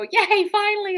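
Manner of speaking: high, gleeful mock cheer; high-pitched "finally at last" celebration. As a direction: cheer like relieved townsfolk. Acting out citizens cheering roadwork.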